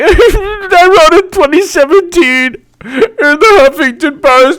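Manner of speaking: very high, slow squealing gloat; tearful laughing high-pitched brag; gloating future self. Pretend. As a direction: squeal it like a victory lap. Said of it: "I'll be like".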